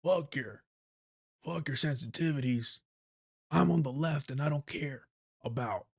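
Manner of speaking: lightly voiced crude guy; a hint of a crass blue-collar rant; mock blue collar rant. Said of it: "go full blue collar".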